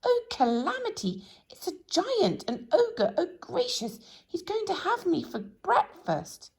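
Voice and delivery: panicked shrieking. A narrator's reading. Mister Jelly panics, sure he will be eaten, shrieking high. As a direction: squeal out every disaster you imagine. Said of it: "he screamed".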